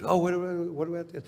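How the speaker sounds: high, eager fan voice; pestering fan. Retold or imitated. A fan pestering Doctor Phil in public, high and eager. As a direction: pester him like a starstruck stranger.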